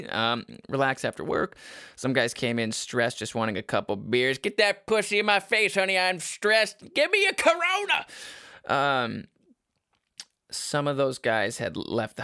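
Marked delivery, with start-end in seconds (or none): 4.4-8.1 high loud brash customer; crude customer voice. Pretend. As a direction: play the crude bar customer. Voicing stressed customer.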